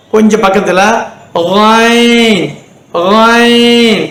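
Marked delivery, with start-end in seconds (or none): 1.3-4.0 ஐன் as a long Arabic 'ghayn'; lightly gargle a gh into ஐன்; light throaty gh. Shown as a demonstration. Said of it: "அப்படியே லைட்டா கொண்டு போகணும்".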